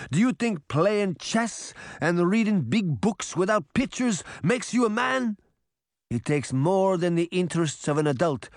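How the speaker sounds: put-on accented old father's high, emphatic scolding; stern, angry Geppetto. A narrator's reading. Geppetto confronts his son over betraying friends, indignant. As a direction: scold indignantly, punching the key words.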